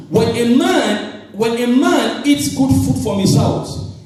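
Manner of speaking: drawn out slowly, leaning on man; slow emphatic. Shown as a demonstration. Preacher to young women, slow and emphatic. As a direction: spell it out word by word. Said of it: "You did not get this one".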